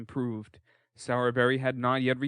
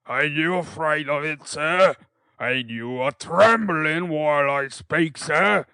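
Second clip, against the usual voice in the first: louder, slow pompous bullying voice; intimidating beadle; 3 semitones higher, 9 dB louder than usual. A narrator's reading. Beadle trying to scare the boy, loud slow pompous bullying. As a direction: try to frighten him with your voice.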